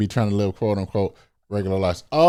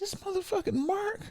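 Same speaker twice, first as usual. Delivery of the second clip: mock disbelief; 9 dB quieter than usual. Joking about spotting Zuckerberg.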